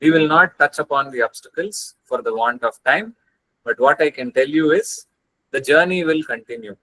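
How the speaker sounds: ordinary talk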